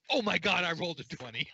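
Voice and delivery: high-pitched, thrilled outburst; real excitement. Real, not acted. Game master reacts to rolling a twenty, thrilled. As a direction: burst out high and loud, then ease off. Player reacts to lucky dice roll.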